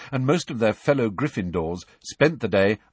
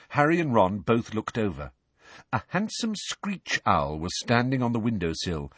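plain reading voice

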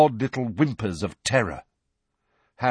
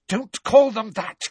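higher, louder, furious; 13 semitones higher, 5 dB louder than usual. Acted. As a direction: snap it furiously. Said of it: "Hermione said furiously".

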